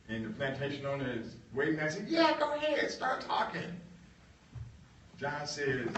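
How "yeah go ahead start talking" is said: mock boss. Boss urging John.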